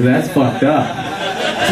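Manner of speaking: slow, laughing aside. Real, not acted. Comic laughs at his dream to the crowd. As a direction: let the laugh drag the words.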